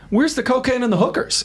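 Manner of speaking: high-pitched joking call-out; mock demand. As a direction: pitch it right up like a cheeky demand. Retelling his joking green-room demand.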